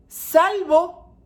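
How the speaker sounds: Salvo called out high and loud; emphatic exception. Real, not acted. Preacher stressing the exception to parents, loudly. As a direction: ring out the word Salvo.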